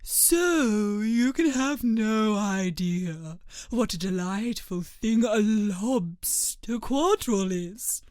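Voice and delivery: slow, drawn-out storybook turtle; a touch of a wistful creature, relishing each word slowly; lightly voiced Mock Turtle. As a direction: draw out the words as the Mock Turtle.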